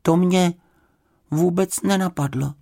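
high, sheepish little bear; embarrassed bear's confession; crestfallen. A narrator's reading. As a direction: admit it meekly in a small high voice. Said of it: "řekl Paddington schlíple".

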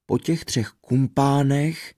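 plain reading voice

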